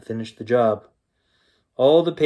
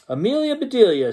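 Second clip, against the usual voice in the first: worried exclamation; 12 semitones higher, 4 dB louder than usual. A narrator's reading. Mister Rogers arrives worried.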